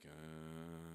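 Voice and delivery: long, low, quiet hum; pondering Napoleon. Acted. Napoleon pondering his price, low drawn-out hesitation. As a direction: draw out a low thinking noise.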